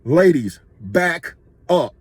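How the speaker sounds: firm, spaced-out command; assertive, deliberate retold order. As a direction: order them back, leaving a pause after the first word. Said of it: "more assertive and aggressive".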